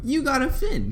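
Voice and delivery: high shocked squeal; high surprised exclaim. Retold or imitated. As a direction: squeal in disbelief.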